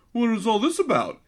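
high, clueless kid voice, mocking; whiny Max voice. Host mocks annoying kid Max asking parents, high clueless voice. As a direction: mimic an oblivious kid butting in. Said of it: "just going like".

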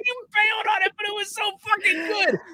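high, shouted exasperated praise; excited protest. Real, not acted. Host protesting his friend's abandoned accent attempt, high and excited. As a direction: cry out in high-pitched disbelief.

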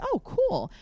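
high, bright oh cool; high chirpy reply. Retold or imitated. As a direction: feign easy enthusiasm. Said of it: "And I'm like".

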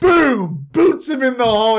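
loud, high mock impact yell; shouted boom. Teller acts out the kick on Joseph with a loud yell. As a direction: belt it out like a cartoon hit.

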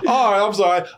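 usual speaking voice

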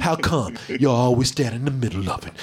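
low mock sass, laughing; repeating the commander's sassy line; sassy quote. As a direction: lean into the attitude. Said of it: "she actually said it with the neck roll".

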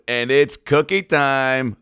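normal talking voice

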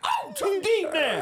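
high, drawn-out falsetto moan; hammed-up falsetto lover; put-on woman's sex voice. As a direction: fake a woman's voice, high and slow. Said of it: "Did you do the voice?".

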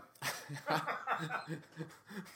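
high amused laugh; helpless giggling laugh; burst of laughter. Real, not acted. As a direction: crack up at a bad accent. Cracks up at the odd accent.

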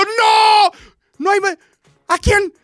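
high, loud, broken-up shouting; comic shouted plea; exasperated protest. Acted. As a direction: argue desperately with your mother. Acted quarrel, pleading with mother.

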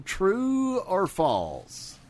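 very slow, dramatic game show ask; drawn-out quiz host. Acted. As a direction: stretch it out for suspense. Final quiz question drawn out.

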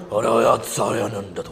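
low, slow fake Japanese gibberish; mock Japanese. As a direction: drag out a mock anime line. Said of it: "todas las japonesas son".

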